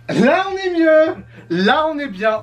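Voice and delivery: high, drawn-out cheer; exclamation enjouée. Acted. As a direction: stretch it out high with showy delight. Reprise enjouée après le faux départ. (exclamation aiguë et ravie)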